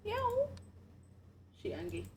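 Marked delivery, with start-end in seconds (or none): 0.1-0.5 high meow. Pretend. Meowing at the cat.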